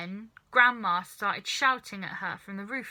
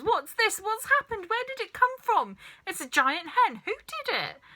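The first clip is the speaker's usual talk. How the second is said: much higher, louder frantic questions; frantic excitement; 12 semitones higher, 5 dB louder than usual. A narrator's reading. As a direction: fire them off in a flap. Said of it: "started jumping about".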